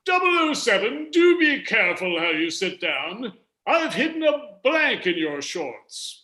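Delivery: high-pitched mock-British spy-gadget chief; British accent. Host reads the Bond gadget chief's line to contestants, British accent. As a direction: put on a drawn-out, swooping British voice. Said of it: "that's a British accent".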